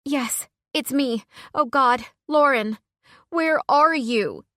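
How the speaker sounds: high, loud and slow with overwhelming relief; frantic relief. Acted. Friend on the phone after the shooting, frantic relief. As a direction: clutch at her friend's voice.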